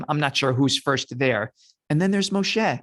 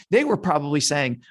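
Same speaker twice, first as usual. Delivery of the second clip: ordinary delivery, nothing marked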